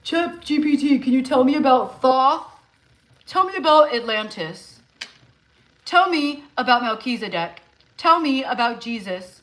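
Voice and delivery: mock naive prompter. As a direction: parody someone typing questions to a chatbot. Mocking spiritual creators asking ChatGPT.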